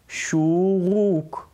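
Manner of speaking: "שורוק" stretched into two long oo syllables; drawn-out "שורוק" to show the oo sound; sound demonstration. Shown as a demonstration. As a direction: say "שורוק" very slowly, holding each oo. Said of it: "הוא נשמע או".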